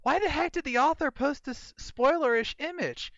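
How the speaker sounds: high, whiny mock complaint; a mock high-pitched complaint about spoilers; mock complainer. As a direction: squeak it out like an indignant fan. They go.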